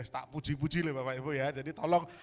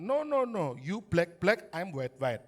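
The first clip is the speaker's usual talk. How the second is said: mock usher in lightly accented broken English; a hint of stiff broken-English usher; 3 semitones higher, 4 dB louder, slower than usual. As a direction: shoo him away in halting broken English. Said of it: "bahasa Inggris saya nggak bagus".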